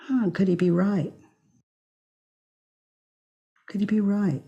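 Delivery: soft, wondering reflection; quietly consider it, open and curious; open curious response. Shown as a demonstration. Modeling the open response to an insult, soft wonder. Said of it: "now listen to this".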